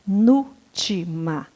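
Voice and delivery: "penúltima" slowly split into separate syllables; slow, measured teacher's dictation of "penúltima". Shown as a demonstration. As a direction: pause between each syllable of "penúltima". Teacher breaks term into syllables.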